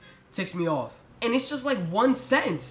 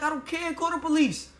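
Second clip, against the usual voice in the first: higher-pitched, dismissive comeback; defiant retort; 9 semitones higher than usual. Retold or imitated. Yelling back at the cashier, high defiant retort. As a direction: toss it back at her, high and scornful. Retold screaming match, his retort.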